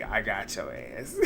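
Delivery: low, soft, slow smug aside; a quiet, slow, gloating smirk. Retold or imitated. Danielle smirking to herself, low, soft and slow. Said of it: "Danielle is sitting there smirking like".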